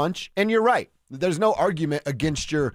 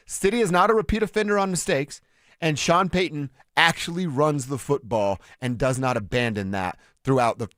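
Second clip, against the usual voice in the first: ordinary talk, nothing marked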